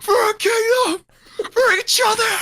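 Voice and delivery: high rallying cry; heroic shout. Acted. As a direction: shout it like a hero. Acting Mario's rallying speech.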